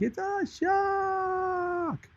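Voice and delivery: a huge, high, drawn-out shout of "It's a shark"; mock greeting shout. People greeting him by name, in an over-the-top drawn-out shout. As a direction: stretch "It's a shark" into one long, high, mock yell. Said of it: "Exactly like that".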